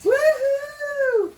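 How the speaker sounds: very high, loud whoop of delight; excited whoop; cheering whoop. Real, not acted. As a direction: cheer like your team just scored. Cheering as package arrives.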